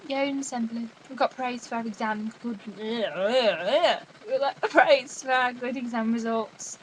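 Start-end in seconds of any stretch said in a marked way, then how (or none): 2.8-6.8 very slow, wobbly stretched delivery; hammed-up slow boast; playful mock-grand announcement. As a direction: draw the words out in a silly wavering voice. Mock celebration of exam praise.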